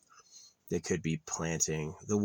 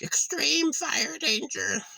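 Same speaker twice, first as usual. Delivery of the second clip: much higher, slow, drawn-out announcer parody; mock official warning; much slower than usual. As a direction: stretch it out like a silly official alert. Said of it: "They're like".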